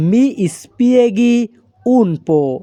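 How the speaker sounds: very slow, every syllable laid out clearly; slow teaching demo. Shown as a demonstration. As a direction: spell the phrase out slowly for learners. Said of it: "এই বাক্যের Italian ভাষা হবে". (ধীরে ধীরে স্পষ্ট করে বলা)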